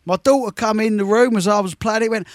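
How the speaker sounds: ordinary delivery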